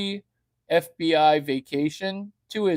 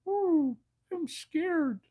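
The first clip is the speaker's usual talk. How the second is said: high, slow mock frightened squeak; scared little voice; 13 semitones higher, slower than usual. Voicing his penis as a mock frightened squeak. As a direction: squeal it timidly. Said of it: "it would say".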